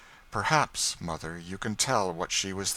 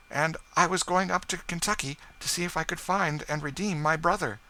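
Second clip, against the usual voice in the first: higher lady's voice, earnest; Madame Desault; 9 semitones higher, 4 dB louder than usual. A narrator's reading. Madame Desault tells her story.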